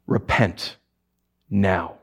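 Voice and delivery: soft, low, grave call to repent; solemn warning. Acted. Preacher warning sinful powers, soft and grave. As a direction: drop it down low and earnest. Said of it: "stern call to repent".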